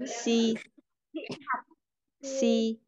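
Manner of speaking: "si" with a light Vietnamese s. Shown as a demonstration. Teacher models pinyin x, light "si". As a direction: say "si" with an uncurled tongue, hissing s. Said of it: "Như là sờ nhẹ của tiếng Việt".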